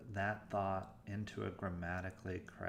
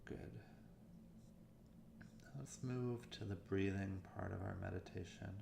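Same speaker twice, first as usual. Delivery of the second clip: softer meditation voice with long pauses; guided meditation; 7 dB quieter than usual. Acted. Meditation coach leading listeners through warm-up exercises, guided meditation voice. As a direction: guide listeners gently, leaving room between phrases.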